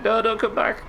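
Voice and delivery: very high imitated plea; panicked warning. Teammate warns rescuer to stay away, panicked imitation. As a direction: mimic a teammate frantically warning you off. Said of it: "that was panic".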